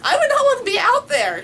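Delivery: high and loud; squealed, half laughing; excited squeal. Real, not acted. Scary lightning storms.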